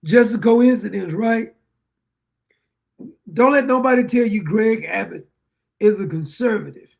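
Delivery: ordinary talk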